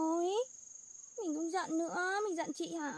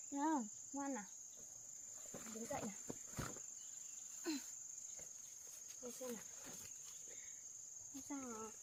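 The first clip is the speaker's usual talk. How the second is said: comforting; 2 semitones lower, 11 dB quieter than usual. Real, not acted. Comforting crying younger sibling.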